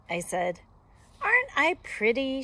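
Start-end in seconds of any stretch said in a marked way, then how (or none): 1.2-2.4 put-on higher, louder vain-girl voice; lightly voiced Little Red. A narrator's reading. Little Red asking the wolf for praise, lightly voiced vain girl.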